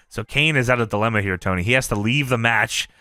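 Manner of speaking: usual speaking voice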